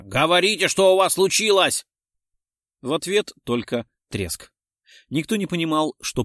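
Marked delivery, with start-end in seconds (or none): 0.0-1.9 loud, high, urgent demand; shouted demand. A narrator's reading. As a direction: shout for an answer over the radio. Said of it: "крикнул комиссар". (громкое, требовательное восклицание)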